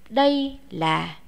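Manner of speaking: ordinary talk, nothing marked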